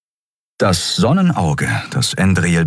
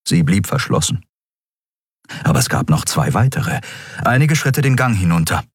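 plain reading voice

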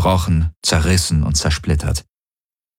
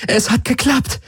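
very high, thrilled cry; jubilant. A narrator's reading. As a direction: burst out in joyful relief. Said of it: "Kirai fiel Galen jubelnd um den Hals".

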